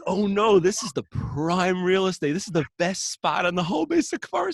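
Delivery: alarmed realization. Retold or imitated. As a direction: panic as you see where you are.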